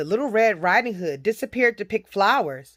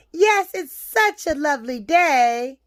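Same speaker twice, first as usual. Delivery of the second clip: put-on higher, polite little-girl voice; 4 semitones higher than usual. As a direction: answer as a friendly child, pitched up. Little girl answers the wolf.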